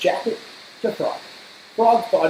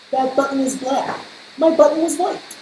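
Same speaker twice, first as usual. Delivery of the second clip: indignant; 8 semitones higher than usual. Acted. Toad rejecting wrong button.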